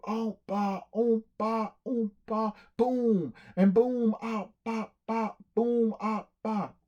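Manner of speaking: high oompa boom, like a brass band; band noises. Acted. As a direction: mimic a marching band with your mouth. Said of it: "And the band played".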